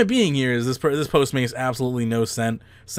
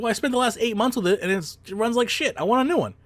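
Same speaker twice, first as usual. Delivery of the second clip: mock entitled customer; 8 semitones higher, faster than usual.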